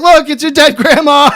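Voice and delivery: high, gleeful announcing; mock prank reveal. Prankster revealing a dead grandma, gleeful.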